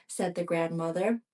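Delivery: plain reading voice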